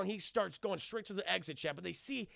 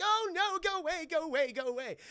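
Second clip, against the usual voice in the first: high-pitched mock panic, easing down; frantic shooing in a hammed-up fright; C-3PO freaking out; 10 semitones higher, 4 dB louder than usual. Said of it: "he's freaking the fuck out".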